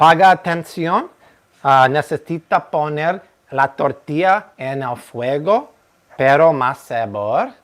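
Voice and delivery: hammed-up Mexican-Spanish accent; mock Spanish lecture. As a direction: scold mijo about the tortillas. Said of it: "I always go".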